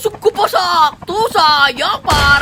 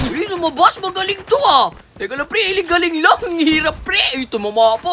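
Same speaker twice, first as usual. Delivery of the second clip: ordinary talk, nothing marked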